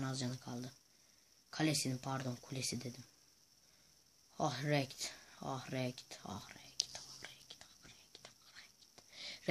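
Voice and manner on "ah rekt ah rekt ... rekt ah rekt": fading whisper. Pretend. (fısıltıyla sönüp giden)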